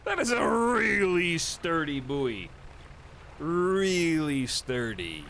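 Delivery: slow, loud, drawn-out deadpan praise; mock impressed. Real, not acted. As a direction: stretch it out in mock awe. After laughing at buoy.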